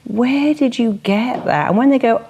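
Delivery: normal talking voice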